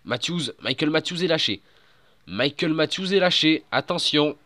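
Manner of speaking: high, loud race caller alarm; excited sports commentary. Acted. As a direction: shout the breakaway news live. Play-by-play commentary.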